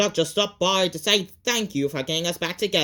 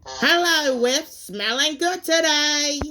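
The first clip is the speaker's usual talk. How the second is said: overly cheerful greeting; 7 semitones higher, 5 dB louder than usual. Acted. Formerly mean Diesel greets kindly.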